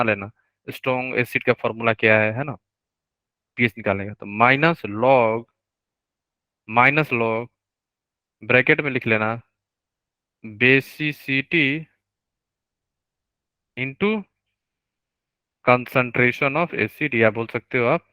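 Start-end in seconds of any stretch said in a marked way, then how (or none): 4.3-7.5 measured, drawn-out words with a pause between repeats; slow dictation. Real, not acted. Teacher dictates formula for notes. 10.4-17.1 stretched-out terms with long pauses between them; slow dictation. Real, not acted. Teacher dictates formula for notes.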